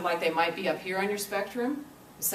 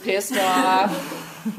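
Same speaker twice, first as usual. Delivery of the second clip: louder, a playful burst of mock anger; mock angry outburst; 3 semitones higher, 10 dB louder than usual. Teacher acting out an angry word for the class, mock angry. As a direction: spit the words out like you're fed up, half in fun.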